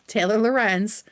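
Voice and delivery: ordinary delivery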